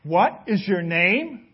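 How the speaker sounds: slow, suspicious demand; a slow, pointed question from the king; narrowed stare. A narrator's reading. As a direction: ask it slowly, eyes narrowed. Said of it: "became beady-eyed and he looked down".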